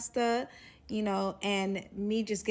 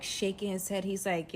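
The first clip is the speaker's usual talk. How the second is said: ordinary talk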